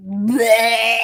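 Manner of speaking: loud mock-retching bleh; disgusted bleh. Real, not acted. Co-host reacts to the baby voice, loud disgusted bleh. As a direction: blurt a loud noise of disgust.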